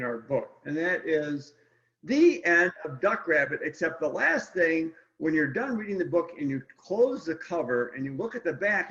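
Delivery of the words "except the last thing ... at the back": usual speaking voice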